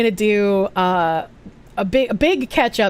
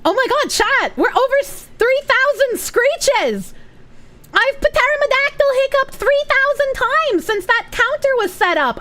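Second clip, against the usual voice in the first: excited outburst; 12 semitones higher than usual. Real, not acted. Streamer announcing a hiccup milestone to chat, high excited outburst. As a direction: squeal the news way up high. Reacts to screech counter milestone.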